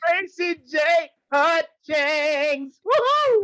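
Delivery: high-pitched hype call ending in a whoop; hyped announcement. Pretend. Host hyping the guest's entrance, high-pitched excited call.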